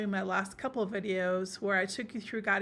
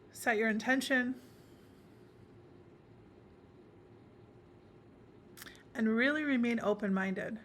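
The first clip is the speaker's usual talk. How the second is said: guided meditation. Acted. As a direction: guide the listener inward, phrase by phrase, leaving space between.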